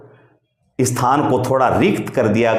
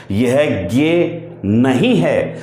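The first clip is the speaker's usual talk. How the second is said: slow emphatic correction; much slower than usual. Shown as a demonstration. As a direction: slowly reject "ज्ञे" as the wrong sound. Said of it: "हम इसका उच्चारण सही नहीं कर रहे हैं". (धीमा, ज़ोर देकर सुधार)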